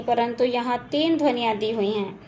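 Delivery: ordinary talk, nothing marked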